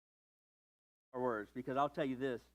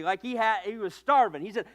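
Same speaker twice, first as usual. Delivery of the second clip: normal talking voice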